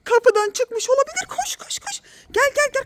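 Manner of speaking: rising, urgent calls; urgent calling. Real, not acted. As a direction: call them urgently after the cat. Door open, cat may be lost.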